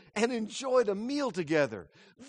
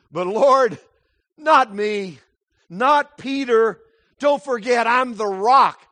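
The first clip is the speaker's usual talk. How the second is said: louder, proud insistence; boastful protest; 4 semitones higher, 9 dB louder, slower than usual. Acted. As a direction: push back like the proud rock. Peter voiced boasting to Jesus.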